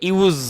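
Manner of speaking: ইউজ with a long buzzing z at the end; voiced z ending. Shown as a demonstration. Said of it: "এই সাউন্ডটা". (ইউজ, শেষে লম্বা ঘোষ জ ধ্বনি)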